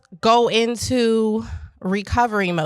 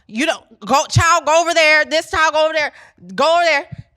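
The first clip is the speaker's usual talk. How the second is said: put-on louder and higher, directing kids; calling out; 6 semitones higher, 6 dB louder, faster than usual. Teacher clearing the room of kids, loud calling out. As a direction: send each child off with a raised voice. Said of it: "I was like".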